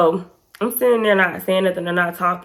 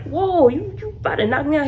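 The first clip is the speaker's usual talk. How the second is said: high-pitched mock alarm; imitated joking boyfriend; 9 semitones higher than usual. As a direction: play the boyfriend's startled joke, pitched up. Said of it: "He was joking with me".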